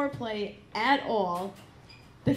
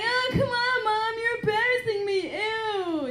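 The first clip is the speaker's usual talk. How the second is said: much higher, whining sing-song; whiny teen daughter; 12 semitones higher than usual. Retold or imitated. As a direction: squeal like a mortified teenager. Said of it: "And she's like".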